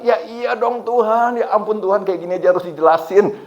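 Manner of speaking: high, indignant mock complaint; retold anger at God. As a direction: whine back in exasperation. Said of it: "Saya marah".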